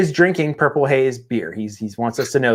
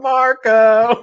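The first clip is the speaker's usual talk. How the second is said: loud, high call across the park; 10 semitones higher, 5 dB louder than usual. Pretend. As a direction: call it out like the pool game. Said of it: "walking around the park going".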